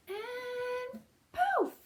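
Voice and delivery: high breathy poof; high soft magical poof. Acted. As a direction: pop it out like a spell. Magic moment in rhyme.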